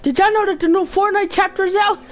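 high, quick gushing question; mock excited announcement. Player telling viewers the new chapter is out, mock excitement.

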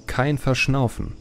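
ordinary delivery

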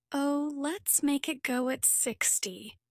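lightly voiced children cheering on the driver; mild excited shout. A narrator's reading. Children urging their half-sister to speed up, mildly shouted. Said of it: "we shouted".

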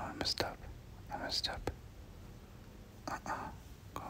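normal talking voice

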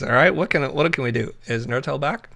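ordinary delivery

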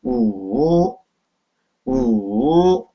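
우 drawn out, dipping low then rising; pronunciation demo. Shown as a demonstration. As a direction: sink low on 우 and swing back up. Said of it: "오는 어떻게 발음한다고 그랬죠?".

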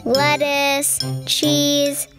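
ordinary delivery

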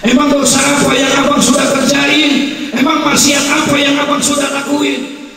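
high, loud, pressing preacher's questions; fervent preaching. Acted. Preacher pressing the young man to confess, loud and high. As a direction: press the sinner hard, at full preaching force.

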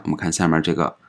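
normal talking voice

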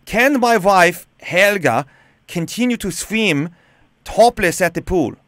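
Borat-like German accent; put-on German accent. Shown as a demonstration. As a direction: ham up a German caller's request. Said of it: "with a German accent".

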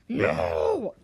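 loud, low, rough bellow; hammed-up roar of grief; mock anguished "No". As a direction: bellow it like a villain in despair. Acted cry after a death.

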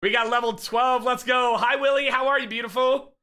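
ordinary delivery

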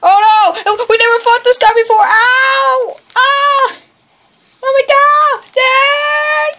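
high, wailing shouts of alarm; panicked cries. Real, not acted. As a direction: cry out as if being grabbed. Attacked by new enemy.

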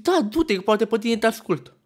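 high-pitched, acted-out "go on then"; exasperated reply. Exasperated reply to his friend, acted.

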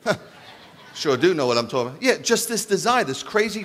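ordinary delivery

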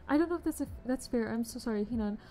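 ordinary delivery

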